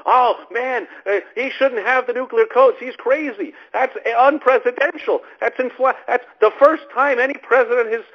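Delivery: hammed-up, high-pitched alarm; panicking critic. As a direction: imitate hysterical critics, pitch rising to a squeal. Mimics panicking anti-Trumpers, rising hysteria.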